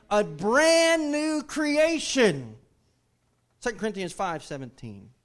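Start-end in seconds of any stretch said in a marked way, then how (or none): 0.1-2.6 stretched out, very slow and loud; slow proclamation. Shown as a demonstration. Preacher has the church chant the verse slowly. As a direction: drag out brand new creation. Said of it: "what, church?".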